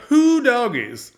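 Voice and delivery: high, loud, slow southern drawl of Who doggies; over-the-top Southern American whoop; hammed-up southern. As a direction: ham up a Southern yokel exclamation. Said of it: "hamming it up as a hilarious character".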